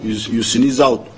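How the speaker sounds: normal talking voice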